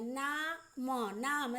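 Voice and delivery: slow, split into ना and म, then joined as नाम; syllables spelled out. Shown as a demonstration. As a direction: sound out ना म slowly, then say नाम. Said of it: "ऐसे मुंह से बोले नाम".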